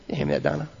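usual speaking voice

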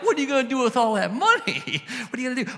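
high and loud, laughing through the question; laughing disbelief. Retold or imitated. Preacher asking the pastor what the million is for, laughing. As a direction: marvel at the sum with a laugh. Retold reply about the million.

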